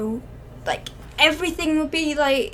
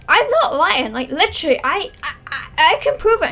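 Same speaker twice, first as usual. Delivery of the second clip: higher and louder, desperate to be believed; high pleading insistence; 5 semitones higher, 9 dB louder than usual. Acted. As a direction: plead loud and high that it is true. Boy insisting he tells truth.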